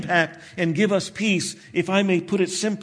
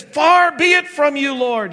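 higher and louder, a retold rebuke; rebuking; 7 semitones higher, 5 dB louder than usual. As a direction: scold him like a headstrong disciple. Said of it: "He began to rebuke Jesus".

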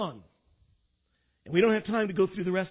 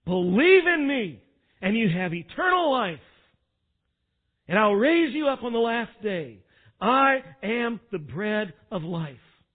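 emphatic proclamation; 6 dB louder than usual. Acted. Preacher restating Jesus' promise as plainly as possible, loud and emphatic. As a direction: proclaim each phrase with full force.

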